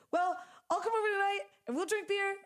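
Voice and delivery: high, fast, bubbly imitation of a friend; imitating Vera. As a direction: chirp it up like your upbeat friend. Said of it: "And she talks like that".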